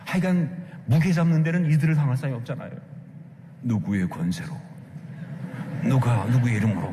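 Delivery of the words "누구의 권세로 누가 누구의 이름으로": low, heavy-handed mock interrogation; 고압적인 말투 흉내. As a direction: imitate pompous officials throwing their weight around, pitched way down. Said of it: "막 이래가면서 막 그냥 우압적으로". (낮게 깔고 무게 잡는 고압적인 목소리 흉내)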